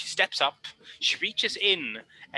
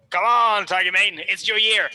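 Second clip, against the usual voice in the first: loud, higher cheer; cheering from the back; 6 semitones higher, 7 dB louder than usual. Acted. Friend cheering Tiger Mane on from the back, loud. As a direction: shout encouragement from the crowd. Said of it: "She, from the back, goes".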